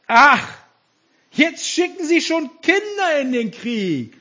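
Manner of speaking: loud, high-pitched scoff; mocking. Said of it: "spottete er".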